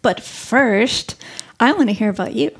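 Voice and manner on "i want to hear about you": ordinary talk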